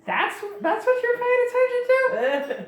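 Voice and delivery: very high, slow mock disbelief; incredulous. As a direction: squeak it out, hammed-up incredulity. Others react to Qualis in disbelief.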